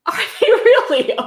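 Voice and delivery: disbelieving laugh at the book; mock surprise. Real, not acted. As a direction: pretend astonishment through a laugh. Sarcastic reply to book's claim.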